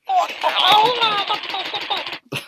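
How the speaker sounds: high squealing laugh. Real, not acted. Toy bird squawks chaos.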